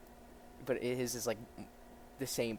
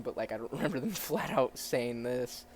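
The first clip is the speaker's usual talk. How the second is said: ordinary delivery, nothing marked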